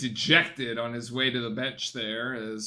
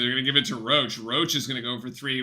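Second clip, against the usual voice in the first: ordinary talk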